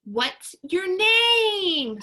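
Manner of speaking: loud, high and slow call from the back; shouted question. A narrator's reading. A classmate shouting to the new girl. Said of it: "someone shouted".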